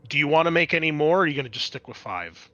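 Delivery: normal talking voice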